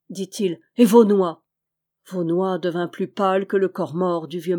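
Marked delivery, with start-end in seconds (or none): 0.8-1.4 pressing harder, loud and eager; louder insistent demand. A narrator's reading. Jude urging the teller on, loud insistent repeat.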